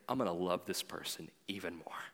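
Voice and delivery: low, soft leaning-in promise; gentle resolve. Retold or imitated. Leaning in to a struggling friend, soft and low. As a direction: say it softly, drawing closer. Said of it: "do you lean in and say".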